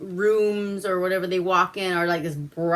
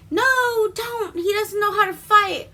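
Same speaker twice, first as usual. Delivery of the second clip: high, louder, urgent plea; alarmed protest; 12 semitones higher, 4 dB louder than usual. Retold or imitated. Franny begs Prospero to stop her boyfriend's fight, high alarmed plea. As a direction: try to stop the fight in panic.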